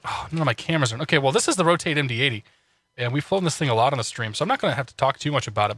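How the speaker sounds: ordinary talk, nothing marked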